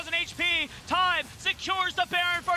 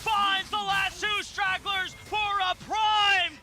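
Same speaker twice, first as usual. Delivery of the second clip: high, slow rising call; excited shout; 6 semitones higher, 4 dB louder, slower than usual. Real, not acted. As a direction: call the teamfight at full volume. Caster calls the teamfight win.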